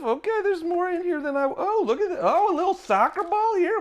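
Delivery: high, quick squeals of discovery; excited delight. Real, not acted. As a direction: gush over each tiny piece. Unboxing delight.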